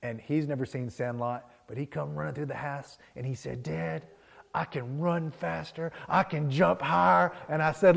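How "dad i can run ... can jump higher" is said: imitating a thrilled boy, higher and slow; boastful little-boy announcement, building louder; excited kid. Son boasting to dad about new shoes, excited.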